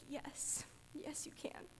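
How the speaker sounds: hushed, tearful near-whisper; choked up. Real, not acted. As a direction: voice almost gone with real emotion. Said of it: "I wasn't planning on crying".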